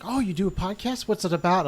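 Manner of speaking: high, loud mock-interested questions; curious party guest. Party guest asking about a podcast, brightly curious. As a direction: pitch it up as an intrigued stranger.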